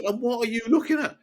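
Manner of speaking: high screechy heckler impression; screeching woman. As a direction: screech it high, imitating an angry fan. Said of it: "she is screeching right behind us".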